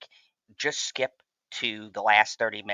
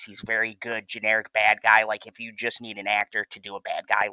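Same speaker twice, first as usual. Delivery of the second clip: normal talking voice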